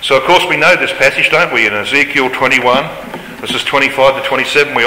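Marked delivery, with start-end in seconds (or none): none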